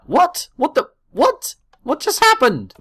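sudden high startled what; jolted, pitch leaping up; shocked what-just-happened yelp; surprised. Real, not acted.

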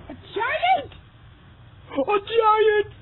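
high, loud shocked gasp. Acted. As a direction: cry it out in alarm. Shocked at kidnapping news.

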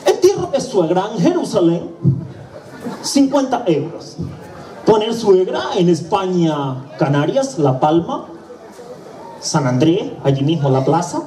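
voz más aguda y lenta de extranjero leyendo un diccionario; funerario extranjero. Acted. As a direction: recita el precio despacio, como quien no sabe español. (funerario de Jerusalén, voz aguda y lenta)

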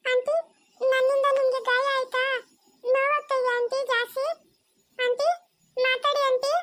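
put-on high child's voice, anxious and pleading; small girl begging the hurt officer to answer; worried little girl. Child worried over injured aunty. (ಆತಂಕದ ಪುಟ್ಟ ಹುಡುಗಿ)

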